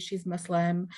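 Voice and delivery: ordinary talk, nothing marked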